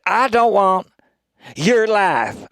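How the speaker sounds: loud, high, emphatic line reading; committed delivery. Shown as a demonstration. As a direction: pause before YOUR LIFE and punch it. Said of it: "I wish he said it like that".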